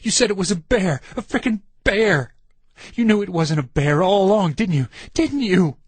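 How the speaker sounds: furious, rising accusation; angry accusation. A narrator's reading. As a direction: hammer him with the charge until he admits it. Furious accusation after the yell.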